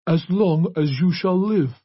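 high, very slow recital; remorseless priest. A narrator's reading. Priest ends the message to her father, remorseless. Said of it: "continued in his remorseless voice".

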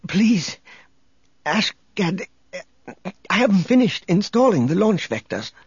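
high, desperate plea; weak pleading. A narrator's reading. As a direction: beg him weakly, voice straining. Weak victim protesting.

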